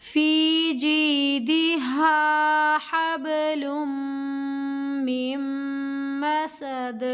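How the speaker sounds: slow, drawn-out chanted recitation; tajweed recitation. Shown as a demonstration. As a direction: recite the verse slowly with each sound stretched. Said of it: "पहली आयत को पढ़ते हैं।". (धीमी, खींची हुई तिलावत)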